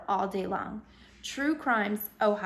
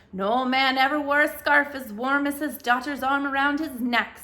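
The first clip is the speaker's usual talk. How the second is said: put-on Irish brogue, louder, fond old man; lilting Irish grandfather sharing a saying; thick Irish brogue; 4 semitones higher, 7 dB louder than usual. A narrator's reading. Ancestor Patrick advising Haley in a thick Irish brogue. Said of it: "Patrick in his thick Irish brogue".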